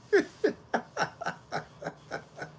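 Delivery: high, breathy burst of laughter; real laugh. Real, not acted. Streamer reading a viewer's onion joke, cracking up. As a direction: crack up in a long giggle.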